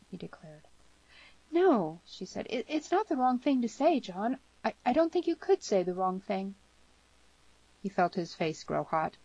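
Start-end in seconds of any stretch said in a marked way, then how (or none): 1.5-2.0 put-on high, louder girl's voice; emphatic higher-voiced reassurance; earnest denial. Girl quick to reassure him. 2.5-6.6 put-on high, halting girl's voice; tender-hearted girl, higher voice; young girl, earnestly reassuring.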